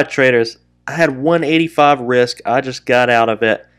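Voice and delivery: ordinary delivery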